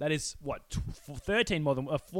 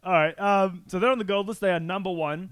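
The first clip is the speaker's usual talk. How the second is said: ordinary delivery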